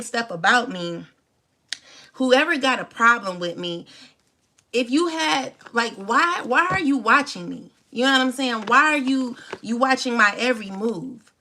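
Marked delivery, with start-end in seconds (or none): none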